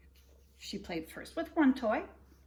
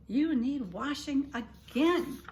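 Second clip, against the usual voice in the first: put-on higher, slow tut-tut at the baby; drawn-out fond scolding; Amelia Bedelia fussing; 5 semitones higher, 6 dB louder, slower than usual.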